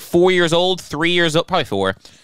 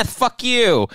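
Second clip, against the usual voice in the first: higher-pitched mock taunt; playful, gloating retold insult; gloating kid; 7 semitones higher than usual. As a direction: sneak in a mischievous jab. Acted gloating kid after hug.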